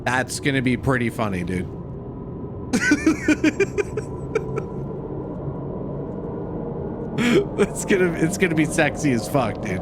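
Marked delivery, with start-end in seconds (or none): 1.7-7.2 long, high-pitched giggling fit; real laughing fit. Real, not acted. Streamer tickled by his killer British AI joke, laughing fit. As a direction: crack up helplessly at your own joke.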